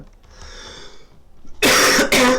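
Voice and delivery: loud real coughing fit; hard coughing fit. Real, not acted. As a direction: cough hard and long, can't stop. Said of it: "je tousse beaucoup tout d'un coup". (quinte de toux forte et réelle)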